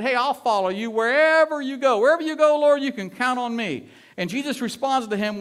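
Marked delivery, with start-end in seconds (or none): 0.0-3.9 loud, high, eager pledge; all excited. Acted. Would-be follower promising Jesus to go anywhere, all excited. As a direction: act out an over-eager volunteer, voice lifted high and loud. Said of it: "he's all excited".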